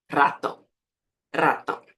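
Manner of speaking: Ratón with a rolled initial R; strong Spanish R. Shown as a demonstration. Teacher modelling the word for repetition, strong trilled R. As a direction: trill the first sound of Ratón. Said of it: "ele vai ter um som mais forte".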